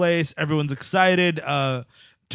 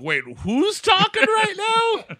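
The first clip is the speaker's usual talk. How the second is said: surprised. Retold or imitated. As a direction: ask it in total confusion. Confused viewer reaction.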